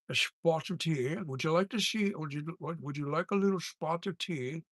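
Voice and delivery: light put-on Irish accent, offering tea; a hammed-up Irish host asking about tea; mock Irish accent. Streamer trying out an Irish voiceover accent, lightly mock. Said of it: "the Irish".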